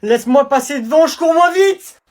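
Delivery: cri aigu de panique; panicked yelling. Acted. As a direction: supplier en fuyant. Running away in panic. (fuite paniquée criée)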